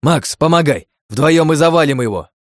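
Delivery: loud battle call; rally your partner mid-fight; shouting. A narrator's reading. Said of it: "крикнул чёрный".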